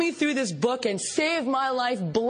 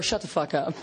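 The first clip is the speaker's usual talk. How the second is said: lower blunt translation; 8 semitones lower than usual. Retold or imitated. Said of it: "lingo for".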